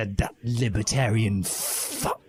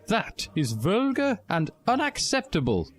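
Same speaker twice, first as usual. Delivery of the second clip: much higher, prim put-on teacher voice; McGonagall voice; 11 semitones higher than usual. A narrator's reading. McGonagall scolding the chanting students, high prim voice. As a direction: gasp it out as a shocked old schoolmistress. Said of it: "gasped McGonagall in a racist voice".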